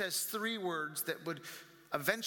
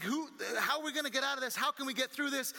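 fast, higher panicked question; 6 semitones higher, faster than usual. Acted. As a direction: rush it like they're sinking. Panicked disciples in storm.

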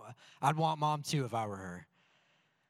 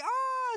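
much higher happy squeal; delighted squeal; 3 dB louder than usual. Retold or imitated. Pastor mimics his happy daughter's squeal, much higher. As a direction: squeal like a giddy toddler. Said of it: "she's like".

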